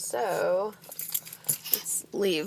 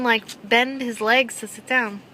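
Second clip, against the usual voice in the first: normal talking voice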